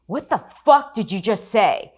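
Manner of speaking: high, loud confrontation, hitting fuck hard; furious shout. Retold or imitated. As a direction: get in their faces and demand it. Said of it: "that's when I lost it".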